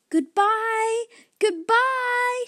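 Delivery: high, loud goodbyes; happily calling goodbye from a rising ship. Acted. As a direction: call up to a friend far below. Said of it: "she called".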